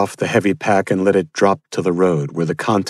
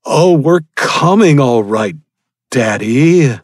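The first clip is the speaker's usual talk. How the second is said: louder, higher, slow sneering villain; mocking cannibal; 7 semitones higher, 6 dB louder, slower than usual. Cannibal leader taunts the father, sneering and loud. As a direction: toy with your victim. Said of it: "said the tall man".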